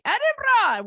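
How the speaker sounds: high mock "Edinburgh" with a fake rolled R; exaggerated, high-pitched "Edinburgh"; fake Scottish pronunciation. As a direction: send up "Edinburgh" as ED-in-BRAH, very high. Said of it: "with my weird American accent".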